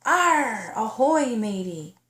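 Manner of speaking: a light pirate voice; mock pirate greeting; pirate talk. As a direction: play at being a pirate. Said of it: "practiced being like a pirate".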